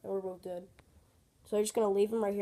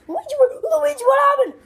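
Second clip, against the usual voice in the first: high, loud alarm at finding someone hurt; alarmed shout; 12 dB louder than usual. Acted. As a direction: cry out in panic for your brother. Finds Luigi hurt.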